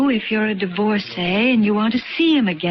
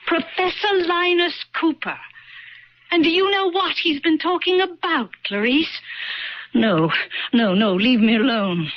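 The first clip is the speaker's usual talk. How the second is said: higher, scornful, slowing at the end; contemptuous; 6 semitones higher than usual. Acted. Younger self berating the old woman. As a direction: sneer at the old fool.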